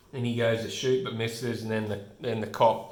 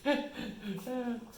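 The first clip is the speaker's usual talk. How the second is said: high, soft giggle tailing off; quiet, high tittering laugh; amused laughter; 12 semitones higher, 8 dB quieter than usual. Real, not acted. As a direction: giggle helplessly at something silly. Laughs at the panel once more.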